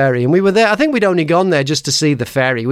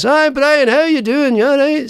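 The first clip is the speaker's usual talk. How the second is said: bright, higher mock greeting from the local man; cheery bloke greeting; 9 semitones higher than usual. The simple local man greets Brian, pitched up and cheery. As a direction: call out hello high and friendly. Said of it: "This bloke comes up and he says".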